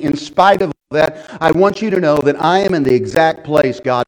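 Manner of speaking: loud, high, ringing sermon voice; full-force preaching. Acted. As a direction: preach Joseph's reply to the rafters. Preaches Joseph's words at full force.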